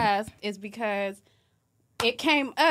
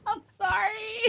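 helpless laughing fit; hysterical laugh; 9 semitones higher, 5 dB quieter, slower than usual. Real, not acted. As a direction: crack up uncontrollably. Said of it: "why are you laughing so hard".

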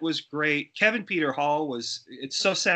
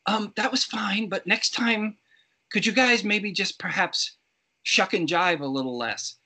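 put-on higher-pitched, polite British accent; nice British accent; 6 semitones higher than usual. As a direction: tentatively suggest it in imitated proper British English. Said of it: "in this very nice British accent".

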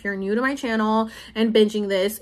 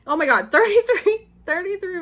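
much higher, real startled exclamation; sudden surprise; 9 semitones higher than usual. Real, not acted. Vlogger suddenly spots the time on the clock, real surprise. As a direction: blurt it out, caught off guard by the clock.

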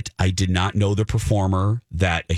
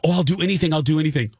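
higher-pitched, lightly imitated pleading; a touch of mock frantic eagerness; desperate to help; 8 semitones higher, much faster than usual. Actress to the girl she hurt, mildly frantic. Retold desperate offer to make amends.

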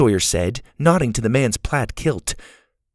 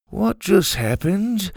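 slow, higher-pitched stunned question; 9 semitones higher, slower than usual. A narrator's reading. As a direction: ask it slowly in mild bewilderment.

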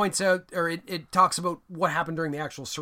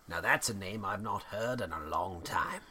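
Obi-Wan Kenobi voice; 8 semitones lower, 7 dB quieter than usual. Retold or imitated. Obi-Wan quote from Star Wars.

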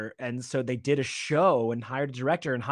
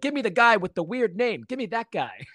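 higher-pitched mock British; bad British accent; 8 semitones higher than usual. Supervisor recalling casting choice, higher bad British accent. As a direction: imitate a Brit badly, pitched up. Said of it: "in my bad British accent".

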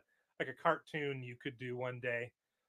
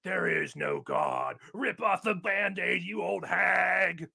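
metal yell; 3 semitones higher, 4 dB louder, slower than usual. Pretend. Imagined cartoon atheist screaming at an old lady in metal growl. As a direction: bellow it like a death metal singer. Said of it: "the most, like, metal tone of voice you can".

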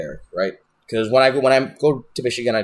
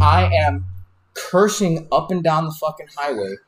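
ordinary talk

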